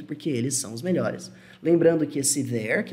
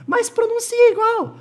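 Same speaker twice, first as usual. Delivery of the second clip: very high, loud falsetto objection; slow, mock-indignant squeal; protesting student; 6 dB louder than usual. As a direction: whine back in a comic squeaky student voice. Voices the student's second protest.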